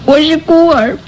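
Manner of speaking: ordinary talk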